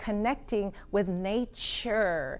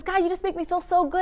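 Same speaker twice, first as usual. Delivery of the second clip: bright gushing praise, higher and louder; gushing friend; 8 semitones higher, 5 dB louder than usual. Retold or imitated. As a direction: gush like an old friend delighted to see you. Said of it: "she always says".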